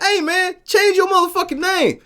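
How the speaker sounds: high mock yell at the screen; retold yell. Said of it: "I'm yelling at the motherfucker like".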